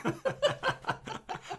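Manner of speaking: unvoiced squealing laugh; high breathy laugh. Real, not acted. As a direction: burst out laughing. Laughs at spouse's joke.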